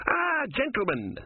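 very high, drawn-out welcome; airy greeting. Acted. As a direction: hail the intruders grandly, high and slow. Philosopher greets armed soldiers.

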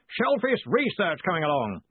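high, blustering long-distance inquiry; hearty yelling. A narrator's reading. Ridcully yells the prompted words.

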